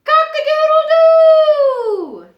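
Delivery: loud, high, stretched rooster crow. Shown as a demonstration. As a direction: crow it out like a proud rooster at dawn. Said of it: "Let's listen to see what it sounds like".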